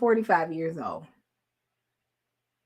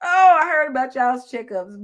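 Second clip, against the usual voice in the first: laughing tease; 6 semitones higher, 8 dB louder than usual. Real, not acted.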